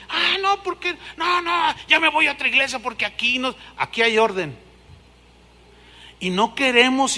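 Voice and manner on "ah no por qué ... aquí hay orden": high huffy mock protest; huffy latecomer. Latecomer threatening to change church, high huffy voice. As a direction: threaten to leave in a huff.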